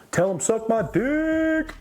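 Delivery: high, drawn-out comic holler; joking yell. Retold or imitated. Clowning for his friends about the caller, joking high yell. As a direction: yell it high and silly, stretching the last word. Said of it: "I yelled out".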